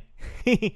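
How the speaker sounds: short high chuckle in character; light chuckle. Acted. Bình jokes with his friend about a treat, chuckling. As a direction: give a little pleased laugh.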